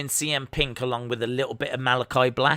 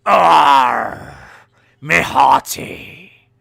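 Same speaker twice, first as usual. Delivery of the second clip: loud, drawn-out mock pirate; hammed-up pirate bellow; pirate talk; 12 dB louder, much slower than usual. Shown as a demonstration. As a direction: roar it like a cartoon pirate. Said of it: "same as talking like a pirate".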